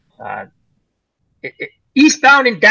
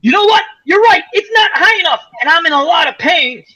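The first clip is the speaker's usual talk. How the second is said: pained outburst; 9 semitones higher than usual. Retold or imitated. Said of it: "And he's like".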